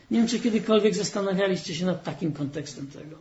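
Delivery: ordinary talk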